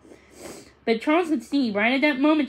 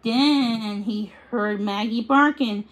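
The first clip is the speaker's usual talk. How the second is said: plain reading voice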